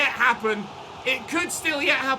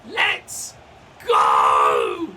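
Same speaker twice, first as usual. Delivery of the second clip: huge loud roar of victory; triumphant yell; 6 semitones higher, 5 dB louder, much slower than usual. Real, not acted. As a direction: scream it at the final whistle. Final whistle celebration.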